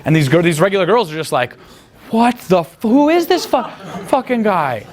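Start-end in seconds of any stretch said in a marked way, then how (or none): 2.1-4.9 very high, stunned girl imitation; mock incredulity. Regular girls stunned by his rudeness, mock incredulous squeal. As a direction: mimic scandalized onlookers in a high voice, breaking up.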